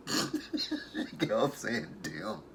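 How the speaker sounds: cracking up, words dragged out through laughs; helpless laughter. Real, not acted. Recapper tickled by Quita saying "them", laughing helplessly. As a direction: barely get it out for laughing. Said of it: "I could not stop laughing".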